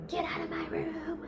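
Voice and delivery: loud, high-pitched yell; angry shout. Retold or imitated. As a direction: order someone out at full voice. Boyfriend yells at her to leave.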